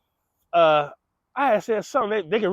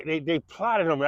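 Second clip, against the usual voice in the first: normal talking voice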